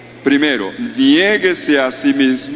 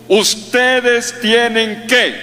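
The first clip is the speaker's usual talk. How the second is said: higher, slow bossy demand; mock the commanding leader who orders people; imposing order; 8 semitones higher, much slower than usual. Preacher mocking a bossy leader to the congregation, higher slow order. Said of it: "Él no habló así".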